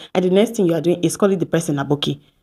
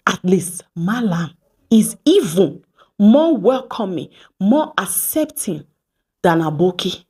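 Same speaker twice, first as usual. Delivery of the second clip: slow emphasis. Real, not acted. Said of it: "slow emphatic advice".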